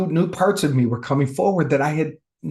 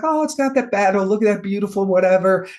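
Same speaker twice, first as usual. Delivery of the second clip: high, airy sing-song delight; mock relaxed; 7 semitones higher than usual. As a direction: float it up high, dreamily carefree. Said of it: "starting to relax and be like".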